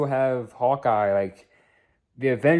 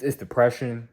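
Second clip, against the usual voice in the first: ordinary talk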